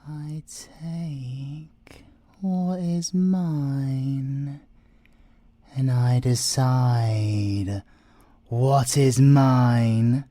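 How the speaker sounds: slow building threat; slow menacing creed. Acted. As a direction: lay down the law, slowly. Thief stating his creed.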